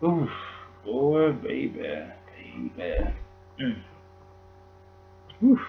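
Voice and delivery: slow, soft, drawn-out sighing words; overcome with grief. Real, not acted. Vlogger overwhelmed by losing a legend, slow and soft. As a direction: let the words sink under the sorrow. Overcome while mourning Wes Craven.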